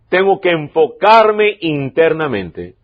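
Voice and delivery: slow, with enfocarme leaned on hard; stress on enfocarme. Shown as a demonstration. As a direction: press down on the word enfocarme, slowly. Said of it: "Énfasis en enfocarme".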